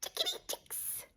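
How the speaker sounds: high breathy whispered chick; tiny chick voice. Acted. Mum voicing the chicks for her kids, tiny whisper.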